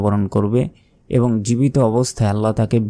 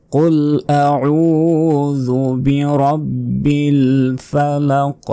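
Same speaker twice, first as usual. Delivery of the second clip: higher, very slow, chanted tilawat; slow, sung Quran recitation; 5 semitones higher, much slower than usual. Shown as a demonstration. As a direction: sing the verse slowly and high.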